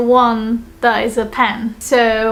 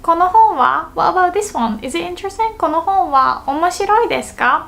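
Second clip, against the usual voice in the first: higher-pitched curious questioner; raised, inquiring example voice; other person asking; 6 semitones higher, faster than usual. Acted. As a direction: play a listener asking about another book. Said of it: "the other person says".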